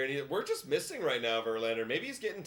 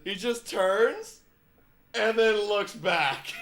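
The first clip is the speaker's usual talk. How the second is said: high, loud and slowed, laughing through the words; can barely get it out for laughing; laughing while talking; 7 semitones higher, 9 dB louder, much slower than usual. Real, not acted. Telling viewers about the umpire's call while laughing hard.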